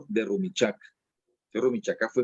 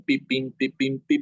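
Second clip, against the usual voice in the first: imitación del tiple; 3 semitones higher than usual. Retold or imitated. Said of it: "ese pim".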